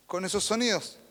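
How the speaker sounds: usual speaking voice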